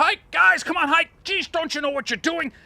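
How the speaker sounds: high, loud teasing call to the crew; mock calling out. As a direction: hail the crew from the helm, ribbing them. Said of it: "and I said".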